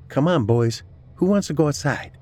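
high-pitched pet-talk voice; coaxing the dogs. A narrator's reading. Woman calls her dogs to go out, coaxing. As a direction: coax the dogs in a light, high voice.

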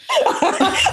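high, breathy laugh; big laugh. Real, not acted. Guest laughing at host's joke about immigration, high laugh. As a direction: cackle in agreement.

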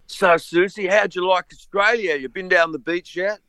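hammed-up high TV host asking Susie about Australia; corny TV interviewer. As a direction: play the eager host asking a bored band about the beach. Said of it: "the guy's like".

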